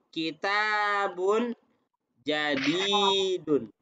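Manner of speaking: kitabun jadidun stretched syllable by syllable; slow example reading. Shown as a demonstration. Teacher giving students an example of kembar, slowly drawn out. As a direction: draw out the long vowels in kitabun jadidun.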